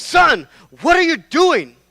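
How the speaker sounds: put-on high, loud, slow old rabbi calling out; stern grandfather. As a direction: boom it out like an old rabbi scolding his son. Grandfather confronts studying son.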